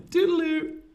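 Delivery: put-on posh old Brit. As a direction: trill it like a dotty old aunt. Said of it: "old-fashioned British people will say this".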